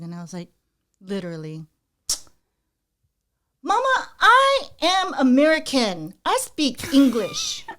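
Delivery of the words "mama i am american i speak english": put-on high, slow, emphatic little kid; mock-indignant child, loud and deliberate; four-year-old self. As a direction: spell it out to mom, word by word. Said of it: "I think I was four".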